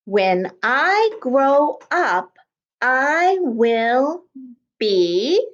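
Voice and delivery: very slow, word by word; slow dictation. Shown as a demonstration. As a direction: stretch each word, pausing between. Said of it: "Let's all write it".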